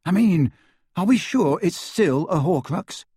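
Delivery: hopeful. A narrator's reading. Ron questions whether it is still a Horcrux, hopeful. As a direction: press the question, angling for good news. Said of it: "asked Ron hopefully".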